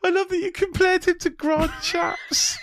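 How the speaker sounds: high, loud, breaking through laughter; can barely get it out for laughing; laughing delight. Real, not acted. Host cracking up at her co-host's comparison, laughing.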